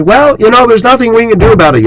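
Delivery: high, fast whiny shrug; high limp mock resignation. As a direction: mimic a weak-willed excuse. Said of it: "just say".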